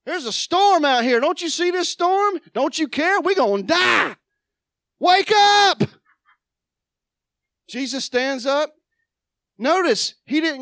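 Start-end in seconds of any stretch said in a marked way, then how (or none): 0.0-5.8 panicked disciples. Acted. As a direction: shake him awake in panic. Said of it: "They go".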